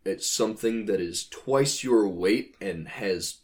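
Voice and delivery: normal talking voice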